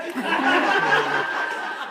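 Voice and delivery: a big unvoiced burst of laughter; loud breathy laugh. Real, not acted. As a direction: crack up loudly. Laughing at own joke.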